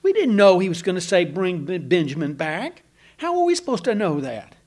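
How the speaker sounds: high, whiny protest, we didn't know; defensive sons. Retold or imitated. Sons defend themselves to Jacob.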